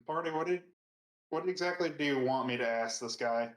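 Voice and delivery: usual speaking voice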